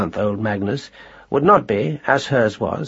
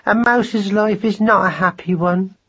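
higher mournful mouse wife; 9 semitones higher than usual. A narrator's reading. Madeline lamenting to her husband, high and sad. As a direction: sigh out the sorrow. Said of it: "cried Madeline".

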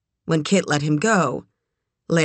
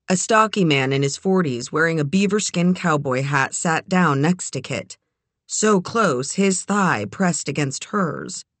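usual speaking voice

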